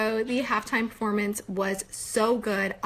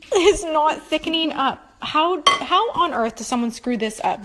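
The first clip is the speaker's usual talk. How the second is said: frustrated; 6 semitones higher, 7 dB louder than usual. Real, not acted. Frustrated baking fail.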